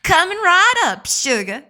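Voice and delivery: put-on sweet Southern accent, serving a customer; playful Southern waitress voice. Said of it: "Not even a bad Southern accent".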